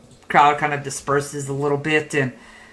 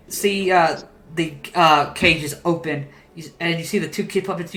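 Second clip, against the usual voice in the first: usual speaking voice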